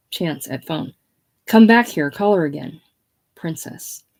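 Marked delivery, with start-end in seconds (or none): 1.4-2.8 loud, raised-pitch order; commanding call. Acted. Chance at phone to princess, commanding. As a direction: summon her back forcefully.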